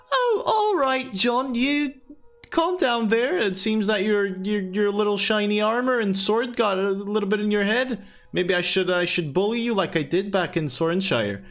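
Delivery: Rosie's teasing voice. Acted. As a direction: tease him high and playful. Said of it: "Rosie goes".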